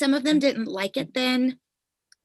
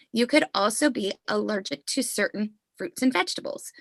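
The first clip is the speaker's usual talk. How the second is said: normal talking voice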